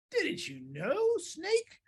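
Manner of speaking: high, slow, incredulous question; mock-surprised mouse. Mouse is asked what a Gruffalo is, mock surprise. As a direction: stretch it upward in disbelief.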